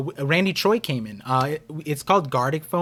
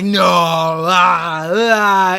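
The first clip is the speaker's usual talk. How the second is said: mock horrified cry; 3 semitones higher, 9 dB louder, much slower than usual. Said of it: "the chat was like".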